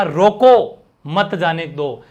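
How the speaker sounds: slow, with a clear break after रोको; pause after रोको. Shown as a demonstration. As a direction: stop on रोको, then give मत जाने दो as its own phrase. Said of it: "यहां पर आपने मना कर दिया". (रोको के बाद ठहरकर, धीरे बोलें)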